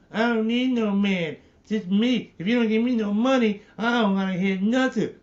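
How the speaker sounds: high-pitched mocking female impression; mock demanding woman. Man mimics women today rejecting men, high mock-demanding voice. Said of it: "Our women didn't talk like that".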